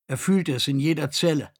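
plain reading voice

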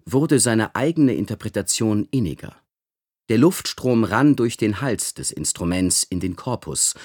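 plain reading voice

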